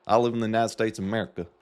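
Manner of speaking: quick, hammed-up Southern accent. Mock patriotic American answering a quiz question, Southern accent. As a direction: play a proud Southerner, twang and all. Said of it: "That was my Southern accent".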